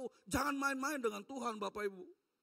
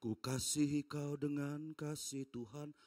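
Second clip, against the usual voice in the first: slow, soft church-song line; slow sweet recitation; 6 semitones lower, 4 dB quieter, slower than usual. Acted. Preacher recites the church phrase softly and slowly. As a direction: gently recite it like a hymn.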